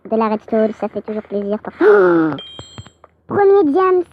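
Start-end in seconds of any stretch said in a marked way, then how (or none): 1.8-2.8 loud, high gasp of surprise; amazed gasp. Real, not acted. Spots the first diamond. 3.3-4.0 high, loud cry of discovery; thrilled excitement. Real, not acted. Spots the first diamond.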